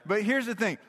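ordinary talk

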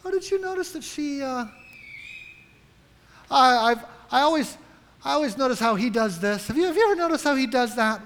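high, breathy-free mock gossip, then rushing; imitating a gossip at church spreading a subtle dig, high and quick. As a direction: play a sly church gossip slipping in a dig. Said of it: "letting that little comment slip".

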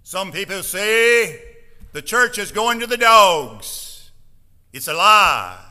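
put-on slow, loud, booming old preacher voice; Brother John's saying. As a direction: boom it out slowly like the old pastor. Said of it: "I'll do my best to say it in the way he said it".

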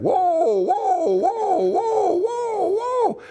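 high rising whoa whoa, climbing in panic; panicked yelling. Retold or imitated. Rider heading for a cliff, frantic rising yells. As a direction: yell whoa as the cliff comes up. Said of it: "he started yelling".

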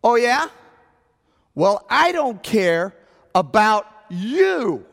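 loud, high, slow retort; re-enacted indignant yell; near top of voice. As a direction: yell it back, stretching the words. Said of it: "close to the top of my voice".

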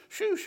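a sudden spurting hiss; gushing noise. Retold or imitated. Describing blood in the sink, a high gushing noise.

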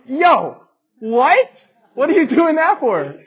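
high, loud startled yell; shocked outburst. Retold or imitated. Owner yelling at the friend drinking from a puddle, startled and loud. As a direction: blurt it out in disbelief.

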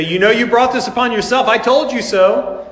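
mock scolding. A father who won't scold the prodigal son, mock scold. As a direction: scold like a smug parent.

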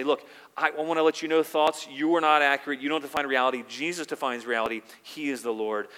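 loud, firm talk to your own thoughts; rebuking thoughts. Acted. Believer rebuking their own thoughts before Jesus, loud and firm. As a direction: tell your thoughts off firmly.

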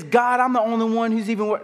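high but restrained complaint to God; protest, holding back the full cry; milder complaint. Acted. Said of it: "he probably didn't say it like".

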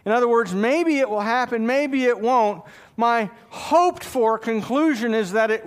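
building sermon. Acted. Pastor to congregation about God's promises, swelling fervent preaching. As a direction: rise into fervent preaching, hammering "always".

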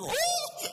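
high, loud panicked whoop; a sudden cartoonish yelp far above normal pitch; Doc Brown scream. Pastor spots the woods on fire, screamed whoop. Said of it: "I sort of screamed like Doc Brown".

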